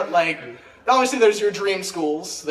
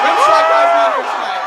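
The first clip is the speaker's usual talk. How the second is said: mock shout; 5 dB louder than usual. Stand-up aside, mock rimshot call.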